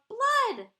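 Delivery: high, loud shriek of one word; yelled alarm. A narrator's reading. Mother sees the hurt finger, high loud yell. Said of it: "Loretta's mother yelled".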